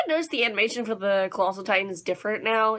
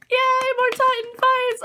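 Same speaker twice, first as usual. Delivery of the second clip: high, drawn-out squealed yay; high-pitched fangirl cheer; giddy cheer; slower than usual. Real, not acted. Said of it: "I'm so excited".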